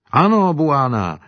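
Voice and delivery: high, slow joyful cry; jubilant. Acted. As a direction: exult over the word Ano. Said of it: "jásal Simba". (jásavě, vysoko a pomalu)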